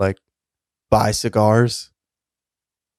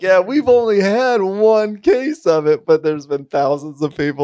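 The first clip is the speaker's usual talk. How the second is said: boastful mock voice; 8 semitones higher, slower than usual. Voicing boastful North Korea.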